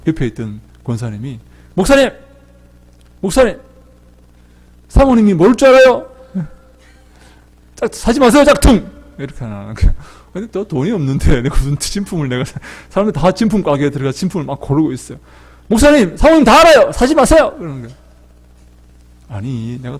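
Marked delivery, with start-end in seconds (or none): 1.7-8.9 high, loud calls across the shop; calling out loudly. Retold or imitated. Deaconess calling the pastor away from the fakes, loud calls. 15.7-17.5 high, loud calls across the shop; calling out loudly. Retold or imitated. Deaconess calling the pastor away from the fakes, loud calls.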